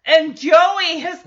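usual speaking voice

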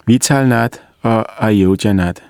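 usual speaking voice

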